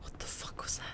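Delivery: startled hushed whisper. Real, not acted. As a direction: breathe it out, spooked. Heard a strange noise.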